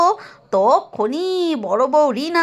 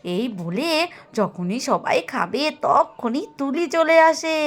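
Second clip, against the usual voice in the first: plain reading voice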